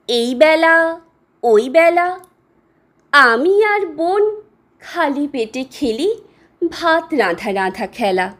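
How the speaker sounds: plain reading voice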